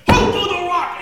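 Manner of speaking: sudden high, loud bellow; furious shout. Retold or imitated. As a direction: blast the question at him. Soldier explodes again.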